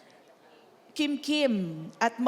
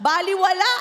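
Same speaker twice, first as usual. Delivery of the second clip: exasperated; 5 semitones higher, 6 dB louder than usual. Acted. Exasperated about kids ignoring.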